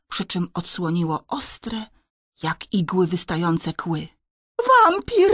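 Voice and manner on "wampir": put-on high, loud boy's shriek; terrified scream. A narrator's reading. Antek spots the vampire on the windowsill, terrified scream. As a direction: cry out in sudden fright, high and loud. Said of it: "wrzasnął Antek".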